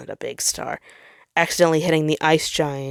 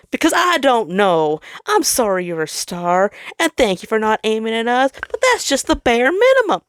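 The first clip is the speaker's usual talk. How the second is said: defensive snap; 6 semitones higher, 4 dB louder than usual. Acted. Jupiter snaps back.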